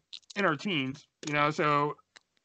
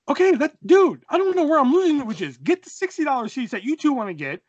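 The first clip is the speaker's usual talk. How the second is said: high, loud, worked-up explaining; exasperated arguing; 11 semitones higher, 6 dB louder than usual. Retold or imitated. Teen arguing seat plan with buddy, exasperated. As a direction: spell out the plan in a frantic, pitched-up protest.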